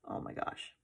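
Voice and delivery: whispered, overcome; breathless whisper. Real, not acted. To viewers about Christy's pregnancy, a moved whisper. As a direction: barely breathe it out.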